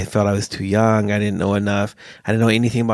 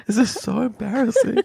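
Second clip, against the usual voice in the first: embarrassed laugh; 10 semitones higher than usual. Retold or imitated. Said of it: "I'm thinking".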